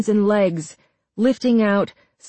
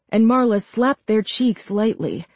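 ordinary delivery, nothing marked

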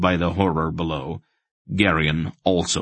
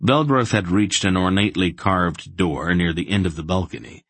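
ordinary delivery

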